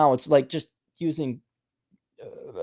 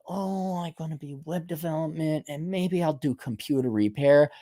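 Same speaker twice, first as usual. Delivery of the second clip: soft, mocking imitation of a dreamer; mocking excuse voice; 7 dB quieter than usual. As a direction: quietly parody someone's lame plan.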